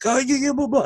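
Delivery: high, wordless-sounding angry babble; mock yelling. Man yelling in the speaker's face, mock yelling. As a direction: mimic his yelling high and dismissively. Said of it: "he's still yelling and stuff".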